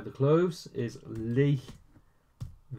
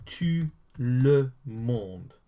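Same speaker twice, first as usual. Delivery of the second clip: slow model pronunciation; much slower than usual. Shown as a demonstration. Teacher introduces French for everyone, very slowly. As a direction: tout le monde very slow, word by word. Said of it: "now, everyone is".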